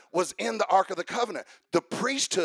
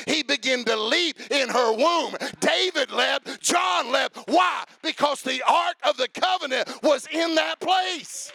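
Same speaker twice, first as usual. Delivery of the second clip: loud, high, rising sermon climax; full-force preaching; 7 semitones higher, 9 dB louder than usual. Real, not acted. Preacher firing up the congregation, shouting. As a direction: preach it to the rafters, building to a shout.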